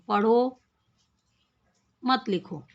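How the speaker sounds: पढ़ो set apart, a clear pause, then मत लिखो; pause after पढ़ो. Shown as a demonstration. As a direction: read पढ़ो alone, stop, then say मत लिखो together. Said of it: "बोलकर पढ़ते हुए विराम चिन्हों का ध्यान".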